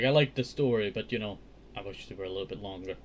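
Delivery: usual speaking voice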